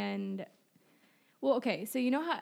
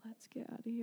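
whispering; 8 dB quieter, faster than usual. Retold or imitated. Quoted wish to leave.

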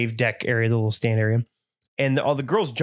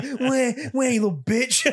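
high, mock crying taunt; 11 semitones higher, slower than usual. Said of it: "He's like".

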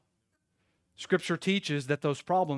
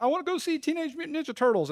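put-on high, quick kid voice; son's eager plea; 10 semitones higher, faster than usual. Preacher imitates his son asking for a movie, high child voice. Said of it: "My son's like".

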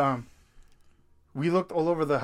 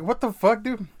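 spooked exclamation; 7 semitones higher than usual. Retold or imitated. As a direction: blurt it out, freaked. Retelling spooked reaction outside.

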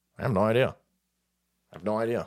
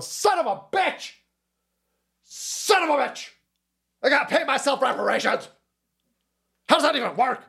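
Jamie Foxx freaking out; 8 semitones higher than usual. Retold or imitated. Streamer mocks a celebrity yelling at his own reflection, high mock outrage. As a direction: squeal it like a cartoon meltdown. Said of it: "He's sitting there going".

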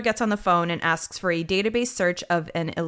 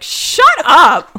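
slow, much louder snap of shut up; mock shout; 8 semitones higher, 13 dB louder than usual. Podcaster yelling at a TV character, loud and drawn out. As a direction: yell it at the screen in exasperation. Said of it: "I just wanna push him out of the frame".

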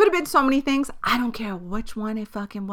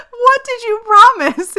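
much higher, louder and slow; incredulous yell; 12 dB louder, slower than usual. Retold or imitated. Shouting at her mom over the promise, incredulous. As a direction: shriek the question in disbelief. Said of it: "I said".

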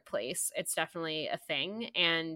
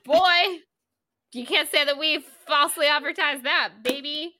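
sassy hype; 8 semitones higher, 9 dB louder than usual. Real, not acted. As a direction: brag with a wink to the listeners. Hyping up the feisty episode.

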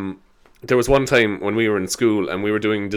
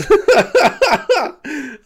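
high, helpless giggling; long high-pitched laughing fit; helpless laughter; 4 dB louder than usual. Real, not acted. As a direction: crack up at your own story. Laughing at own story.

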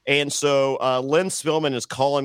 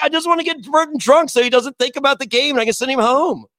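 higher, a bit louder, bubbling with the plan; raised, louder retelling of a gleeful scheme; giddy scheming; 9 semitones higher, 4 dB louder than usual. Retold or imitated. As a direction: sell the mischief, bright and eager. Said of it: "'cause I'm just like".

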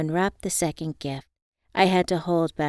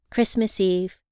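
ordinary delivery, nothing marked

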